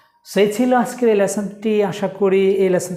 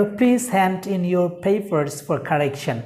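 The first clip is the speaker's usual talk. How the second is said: slow dictation; much slower than usual. Shown as a demonstration. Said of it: "তাহলে কি দাঁড়ালো".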